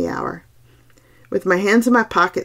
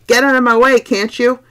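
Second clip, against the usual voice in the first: urgent, higher and louder shout; 6 semitones higher, 6 dB louder than usual. A narrator's reading. Narrator to the figure blocking his path, shouting. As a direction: shout someone out of your path.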